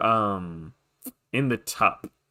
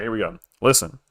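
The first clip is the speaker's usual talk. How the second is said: ordinary delivery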